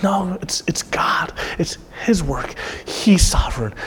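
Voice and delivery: soft, near-whispered reassurance; hushed conviction. Real, not acted. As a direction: let it drop to a reverent hush. Moved, voicing what the word says.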